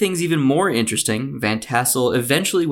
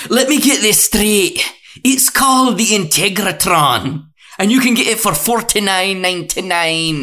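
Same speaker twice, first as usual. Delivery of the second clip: TV shopping pitchman; 7 semitones higher, 5 dB louder than usual. Acted. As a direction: sell it like a QVC host. Mock QVC sales pitch.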